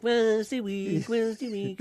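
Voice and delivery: slow high mimic; mocking drawn-out voice. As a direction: drag it out high. Imitating the man's reply.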